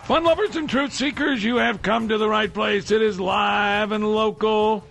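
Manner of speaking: loud, high, hyped show opener; radio host intro. Acted. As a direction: boom out the welcome like an announcer. Host opens the live show.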